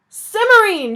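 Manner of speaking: loud, high joyful cry; shouted. Acted. King seeing his lost wife, shouting. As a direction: shout her name in sudden joy. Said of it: "shouted Father".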